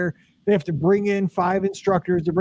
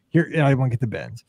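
ordinary talk

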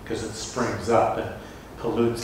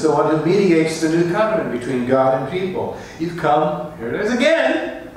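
full-force preaching; 5 semitones higher than usual. Acted. Preacher to congregation on coming to Zion, full-force preaching. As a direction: ring it out like a preacher at the peak.

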